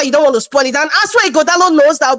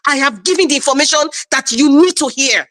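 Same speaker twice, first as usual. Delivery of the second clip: ordinary talk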